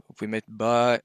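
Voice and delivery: normal talking voice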